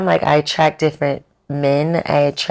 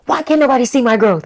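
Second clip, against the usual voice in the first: high, fast plaintive whine; mock whining complaint; 8 semitones higher, 3 dB louder, faster than usual. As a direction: complain like nobody notices you, hammed up. Acts out her earlier frustrated complaint.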